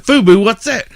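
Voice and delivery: high, loud puzzled question; puzzled comedian. Retold or imitated. The comedian asks the girl about the shirt, high and loud. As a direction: play dumb, loud and high.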